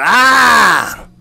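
drawn-out acted yell of pain; storyteller's scream. A narrator's reading. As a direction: cry out "Ah!" high and sustained. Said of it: "he screamed out".

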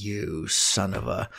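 soft, slow half-swallowed curse, cut off; muttered mock curse. To his cohost who posted first, a soft slow mock curse. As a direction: grumble it under your breath, then stop. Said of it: "and I was like".

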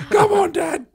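high, loud goading call; mock taunt. Kid goading dad, high and loud. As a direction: egg him on in play.

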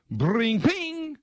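high twanging ping like a snapping string; string snap noise. Retold or imitated. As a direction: imitate the guitar string popping.

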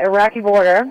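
ordinary talk, nothing marked

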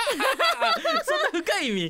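high, slow words squeezed out through laughter; laughing in disbelief. Real, not acted. Cohost laughing in disbelief at her serious reason for loving John Connor.

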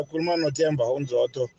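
usual speaking voice

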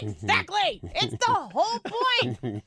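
high, excited exclamation; fired-up, drawn-out endorsement; emphatic agreement. Real, not acted. Enthusiastic agreement with quoted line.

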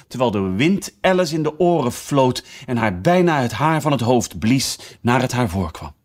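usual speaking voice

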